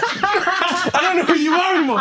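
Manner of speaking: high, laughing mock of the hurt friend. Retelling the friend's storm-off, high and laughing. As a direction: replay his wounded line, cracking up. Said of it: "And he's like".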